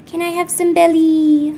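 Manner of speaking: high, loud, sing-song begging; wheedling pet talk. Real, not acted. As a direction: wheedle the pet, holding the last word long.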